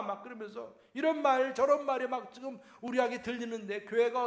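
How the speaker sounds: high, loud, impassioned sermon; preaching. Acted. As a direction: build it up like a revival preacher. Preaching at full force.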